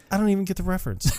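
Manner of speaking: high, fast imitated protest; retold bewilderment in a high little rush; mock clueless denial. Retold denial of band references.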